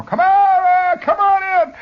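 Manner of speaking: high, loud call out the back door; mother calling out. Retold or imitated. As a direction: shout the kids home from the backyard. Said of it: "mothers calling out".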